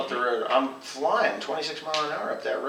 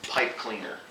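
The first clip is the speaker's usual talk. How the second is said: normal talking voice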